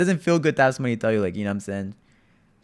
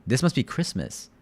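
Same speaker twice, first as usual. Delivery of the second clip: ordinary talk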